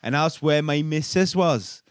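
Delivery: put-on Welsh accent reading a statement. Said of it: "how good his Welsh accent is".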